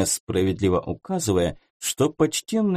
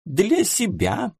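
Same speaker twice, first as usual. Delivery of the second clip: slow, high rising query; taken aback by a new idea; surprised question; 9 semitones higher, slower than usual. Acted. Client echoing the modiste's words in surprise.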